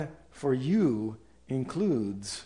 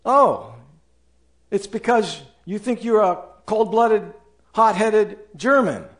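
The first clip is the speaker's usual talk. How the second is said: a higher, slightly louder defensive voice; mock excuse; 5 semitones higher, 4 dB louder than usual. A churchgoer blaming his German blood, mock defensive voice.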